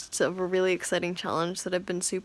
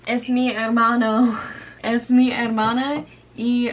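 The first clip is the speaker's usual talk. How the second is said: laughing; 3 semitones higher, 7 dB louder than usual. Real, not acted. Laughing through practice phrases.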